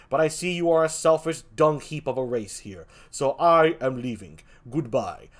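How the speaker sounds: solemn, low-key talking-creature pronouncement; calm deep Pikachu. Acted. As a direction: speak calmly and deeply, like a wise visitor. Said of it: "in a very calming and deep voice".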